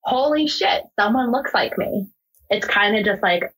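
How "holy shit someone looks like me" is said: retold shock. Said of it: "to have that shock of".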